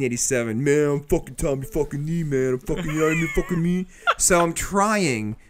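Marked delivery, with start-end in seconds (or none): 0.6-3.9 lightly hammed-up rock-star impression; mock eighties rocker swagger. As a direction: play a sweary hair-metal dude, a bit raised. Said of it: "I sound like I'm in a [name] video".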